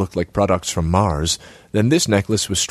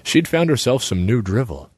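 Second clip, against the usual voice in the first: plain reading voice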